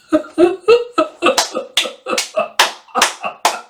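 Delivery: high breathless laugh; delighted laugh. Real, not acted. Player delighted at the scene, laughing. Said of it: "I love this so much".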